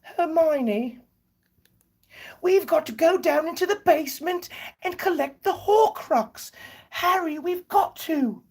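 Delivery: put-on very high falsetto English accent; hammed-up, high-pitched London accent; Harry Potter character. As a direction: plead urgently in a squeaky British voice. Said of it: "So that's like Harry Potter".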